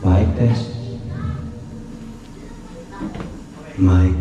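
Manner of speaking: repeated mic tests with echo; digital echo. Real, not acted. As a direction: keep testing the echo mic. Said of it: "May echo, mahal".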